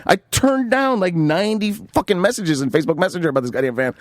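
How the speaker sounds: high-pitched retold indignation; exasperated retort. Retelling his angry argument with buyer.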